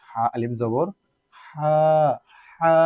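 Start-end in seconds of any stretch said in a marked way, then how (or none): 1.4-2.7 হা with air flowing out, aa held long; long, airy Ha. Shown as a demonstration. Teacher shows air coming out, breath-filled long হা. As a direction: push breath through the h of হা and stretch the vowel. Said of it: "বাতাস বের হবে".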